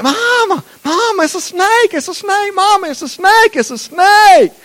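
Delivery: put-on high, frantic little boy cry for Mama; child crying snake. Little boy crying out to Mama in fake panic. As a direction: wail it like a scared little kid.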